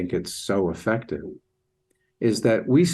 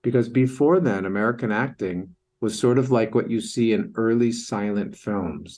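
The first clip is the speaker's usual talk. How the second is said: ordinary delivery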